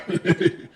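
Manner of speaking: brief amused laugh; short chuckle. Real, not acted. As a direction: let out a little chuckle. Laughs at own admission.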